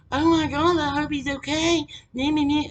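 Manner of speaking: high, mock-worried mother voice; mocking mom. Daughter mocking mother's fake worry, high mock voice. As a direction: ham up her self-pitying concern.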